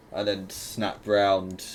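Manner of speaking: ordinary delivery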